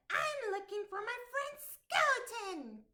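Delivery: high, eager little goblin voice; squeaky-high goblin searching for his friend. Acted. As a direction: play a small hopeful goblin asking around. Goblin speaks to troll.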